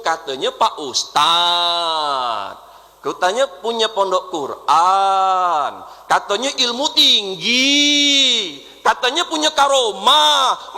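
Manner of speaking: slow, building to a loud scornful shout; mocking rebuke. Real, not acted. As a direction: drag each claim out, swelling.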